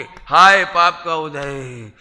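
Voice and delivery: slow, loud hammed-up lament; mock wailing. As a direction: wail it like a fake mourner. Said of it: "ऐसे करोगे, ऐसे".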